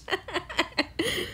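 soft, breathless giggling; trailing giggle. Real, not acted. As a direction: let the laugh tail off quietly. Amused at own confusion.

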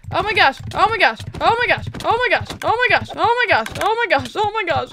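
frantic, repeated exclamations; panicked repetition. Real, not acted. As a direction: rattle it off over and over in alarm. Panicking in boss fight.

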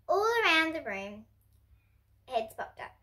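usual speaking voice

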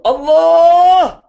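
high, loud, very slow cry of Allah; long ringing call, drawn out. Acted. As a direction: stretch the name out like a devotional call. Paid man calls God's name again.

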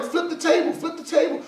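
high-pitched, amused urging; mock egging on. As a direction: squeak it out like a hype man, laughing. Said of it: "he was like".

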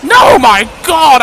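loud, high panicked ranting; frantic. Real, not acted. Player panicking as monsters attack him. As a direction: rant as monsters swarm.

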